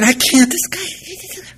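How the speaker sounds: high strained protest climbing to a squeak; exasperated squeal. Retold or imitated. Blocked passenger answering the queue, exasperated squeal. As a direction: squeak it out helplessly, fading away.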